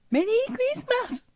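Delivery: very high, raspy quick falsetto; husky cute voice. Acted. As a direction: push for cuteness until it cracks into hoarseness. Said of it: "クリスマスがすごいかすれてる". (かすれた可愛い声)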